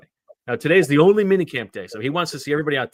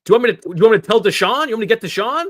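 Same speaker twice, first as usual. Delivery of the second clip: fast, higher, louder mock threat; imitating angry yelling; 8 semitones higher, 6 dB louder, faster than usual. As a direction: threaten them like a furious boss. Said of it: "Howie Roseman yelling at these two guys".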